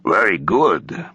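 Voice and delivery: put-on slow, savored Russian-accented approval; Russian accent, drawn out appreciatively. A narrator's reading. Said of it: "he had never quite lost his Russian accent".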